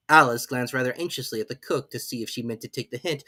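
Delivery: ordinary delivery, nothing marked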